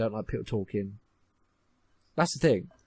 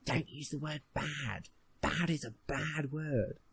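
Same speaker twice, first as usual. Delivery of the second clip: slow, soft, put-on teacher voice; mocking teacher; 5 dB quieter, slower than usual. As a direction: drawl it like a prissy English teacher. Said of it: "and he says".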